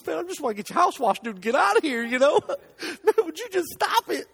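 high, loud, teary plea breaking into laughs; laughing through mock tears at a customer; retold crying plea. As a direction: beg him to stop while half crying, half laughing. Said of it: "you know, crying".

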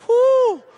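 high, loud "whoo"; freaked-out whoop. Retold or imitated. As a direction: let out a high mock-frantic whoop. Acts out sweating, freaked-out self.

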